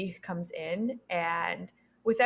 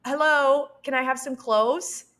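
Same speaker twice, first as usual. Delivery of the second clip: loud, high exasperated hello; indignant call; 6 semitones higher, 9 dB louder than usual. Retold or imitated. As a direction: demand your clothes, fed up. Naked, demanding clothes from firemen.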